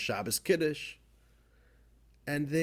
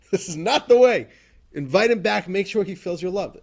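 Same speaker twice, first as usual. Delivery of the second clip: loud, higher, insistent command; upset rebuke; 6 semitones higher, 10 dB louder than usual. Retold or imitated. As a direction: demand they bring him back. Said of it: "The Alter, he was so upset".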